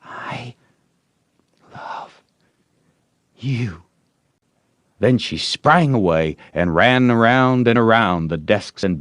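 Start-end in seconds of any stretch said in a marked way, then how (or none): 0.0-3.8 very soft, shy whisper; timid girl's whisper. Acted. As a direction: breathe it into his ear. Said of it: "and whispered".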